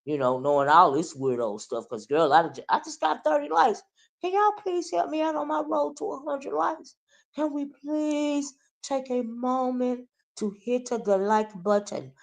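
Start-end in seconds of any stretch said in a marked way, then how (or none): 2.7-12.1 high sing-song appeal for likes; pleading for likes. Acted. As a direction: put on a high little plea to the audience. Mock plea for likes.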